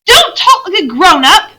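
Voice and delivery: put-on high, loud childish protest; indignant girl. Lucy snapping at Susan, high, loud and indignant. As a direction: stamp your foot as you say it. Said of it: "said Lucy, stamping her foot".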